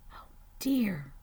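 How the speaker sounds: hushed, gasped dismay; storybook dread under the breath; alarmed whisper. Acted. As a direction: whisper it after a frightened gasp. Animals fear the bear will wake.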